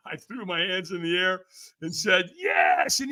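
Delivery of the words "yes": high, drawn-out triumphant shout; all-in shouted yes. Retold or imitated. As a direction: yell it with total commitment. Answers screamed demand, hands up.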